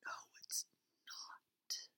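breathy ghost whisper, barely audible; imitating a close, eerie whisper; imitated whisper. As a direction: whisper it right into someone's ear. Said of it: "sounding more like".

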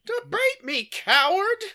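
joining in the mock debate bluster, high and loud; mock challenge shout. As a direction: yell it like a cartoon blowhard. Joining the mock libertarian rant.

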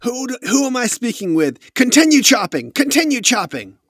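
high, loud, imperious orders; commanding woman leader. Acted. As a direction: cry out orders as the woman in charge. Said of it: "hear her start giving commands".

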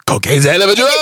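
high, put-on comic voice; catchphrase impression. Shown as a demonstration. Performing an impression for the co-hosts, hammed-up. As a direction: do the famous line as a high-pitched impression. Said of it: "I can do a pretty good impression".

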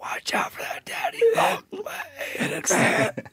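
high, slow, tearful whisper; soft echoing dream voice. Pretend. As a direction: whisper it slowly through fake tears. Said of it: "you gotta do it all in the voice".

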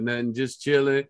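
low male voice. Retold or imitated. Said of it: "He's like".

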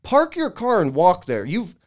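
imagined telling off. Pretend. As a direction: lecture the old guy in an exasperated rant. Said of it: "It's like".

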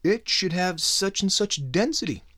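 high nerdy mocking voice of the scientists. Podcast host mocking scientists, in a high nerdy voice. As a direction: lift into a prissy know-it-all tone. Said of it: "they're like".

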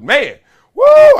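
loud high whoop; excited whoop. Real, not acted. Host hyping his weekend with a loud woo. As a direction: let out a big celebratory woo.

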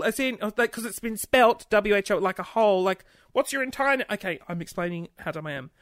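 normal talking voice

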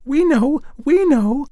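very high, loud chirpy sparrows; chirping sparrows. A narrator's reading. Sparrows tell the fir tree in very high chirps. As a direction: chatter it out like little birds. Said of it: "chirped the sparrows".

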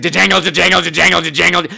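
rapid rhythmic jangle noise; imitated jangling. Teller mimics the bracelets, imitated jangling. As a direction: chatter it like tambourines on a wrist. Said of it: "It was like".

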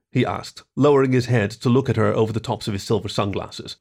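usual speaking voice

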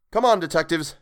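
high, loud urging; rally the gang to give chase; rallying call. A narrator's reading. Boy rallies his friends.

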